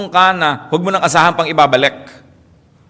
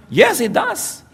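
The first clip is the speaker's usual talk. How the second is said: high Peter voice; much slower than usual. Acted. Peter answers tax collectors.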